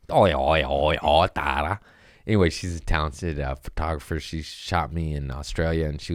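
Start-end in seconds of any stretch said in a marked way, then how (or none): 0.1-1.8 a hint of an Australian accent, Oi oi; lightly mocking Australian Tara. Mocking how an Australian photographer talks, in an Australian accent. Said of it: "They talk funny down there".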